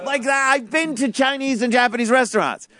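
usual speaking voice

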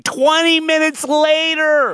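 loud, high exclamation; incredulous emphasis. Real, not acted. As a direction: belt it out in disbelief. Incredulous retelling of mother's delay.

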